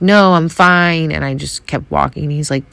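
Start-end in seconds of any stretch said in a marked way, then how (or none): 0.0-1.1 higher, louder, slow dismissive no; brushing him off. Retold or imitated. Crying woman brushing off the stranger following her, dismissive. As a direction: wave off the stranger's concern. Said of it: "I was like".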